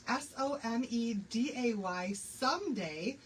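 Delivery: "S O M E D A Y" spelled letter by letter, then "someday" said slowly. Shown as a demonstration. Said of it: "So the keyword for today is someday".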